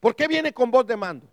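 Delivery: preacher's challenging question; insistent question. Real, not acted. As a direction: press the question on the congregation. Preacher repeats rhetorical question, first forceful.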